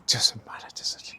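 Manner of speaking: soft whisper, almost no voice; whispered aside. Real, not acted. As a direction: whisper it like a little secret.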